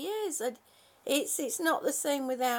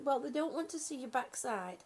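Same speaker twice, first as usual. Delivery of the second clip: ordinary talk, nothing marked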